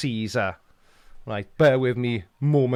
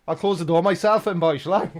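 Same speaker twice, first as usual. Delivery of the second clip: higher, pointed call after someone leaving; mock reproach; 6 semitones higher, 3 dB louder than usual. Real, not acted. Dad calling after his son who left the door open, mock reproach. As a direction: call it out as a pointed parental complaint.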